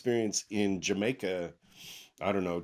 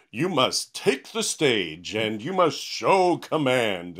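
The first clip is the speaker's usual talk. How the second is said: slow, mock-grand stage voice, a little louder; old-school commanding speaker; 3 semitones higher, 6 dB louder, slower than usual. Mocking how speakers were taught to sound, slow and grand. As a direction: lampoon the stiff speaker of decades ago. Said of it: "who speaks like that anymore?".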